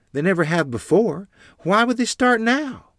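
high, loud challenging questions; probing. A narrator's reading. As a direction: press him for an answer, pitch climbing. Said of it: "Mother probed".

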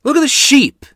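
loud, high, booming order; father's angry shout. A narrator's reading. Father points out the sheep, shouting furiously. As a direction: roar at the careless children. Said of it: "he continued".

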